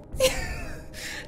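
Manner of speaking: breathless high giggle; airy giggle. Real, not acted. Streamer laughing at her own Canada rant, giggling. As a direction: let a silent laugh escape.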